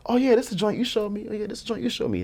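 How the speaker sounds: high-pitched, repeated in eager recognition; pitched well up, thrilled to remember; retold delighted recognition. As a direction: imitate an excited fan spotting a track. Retold star recognising his music.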